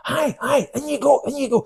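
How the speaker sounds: squeaky mock "in you go"; high mock insisting. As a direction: shoo him in with a high voice. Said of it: "I goes".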